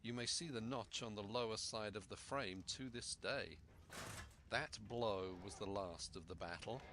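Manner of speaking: plain reading voice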